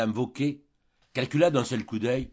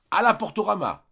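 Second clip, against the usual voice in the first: high, loud mocking cry; jeering shout; 9 semitones higher, 5 dB louder than usual. A narrator's reading. Boarders mock the pair being expelled, jeering shout. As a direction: call it out as a taunt, pitched well up.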